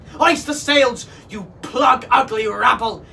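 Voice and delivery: rousing pirate bellow; captain's yell. A narrator's reading. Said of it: "yelled the captain at his crew".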